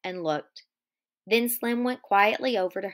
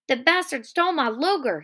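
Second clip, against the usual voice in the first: loud, higher read-aloud shout; 5 semitones higher, 4 dB louder than usual. Acted. Carlson bursting in about his missing gun, loud shout. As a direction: yell it across the barn. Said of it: "he shouted".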